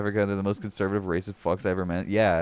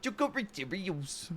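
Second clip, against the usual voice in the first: put-on higher, softer mangled South African accent; mock South African accent; 9 semitones higher, 9 dB quieter than usual. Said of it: "That's how they sound".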